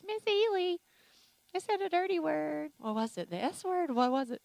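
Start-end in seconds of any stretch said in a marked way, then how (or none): 0.0-2.7 put-on high, tattling little kid; kindergartner voice. Kindergartner confessing to the teacher, high child voice. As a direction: imitate a small child confessing, pitched way up. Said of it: "My kindergartners".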